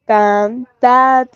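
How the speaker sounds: "cantad" split slowly, TAD stressed; "cantad" syllable by syllable, weight at the end; stress on last syllable. Shown as a demonstration. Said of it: "el acento ocupa la última sílaba".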